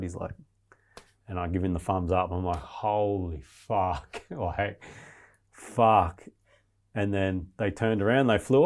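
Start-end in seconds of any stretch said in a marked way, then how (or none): 2.6-6.2 slow, drawn-out amazement; awed. Retold or imitated. Seeing the yellow helicopter come up the valley, slow awe. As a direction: stretch it out in disbelief.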